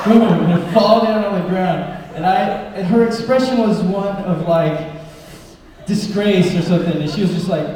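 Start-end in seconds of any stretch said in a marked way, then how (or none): none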